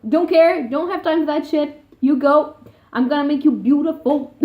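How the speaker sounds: high, loud and bossy, brushing her off; bossy Genya. Retold or imitated. Genya bossing Alina into getting ready, loud and high.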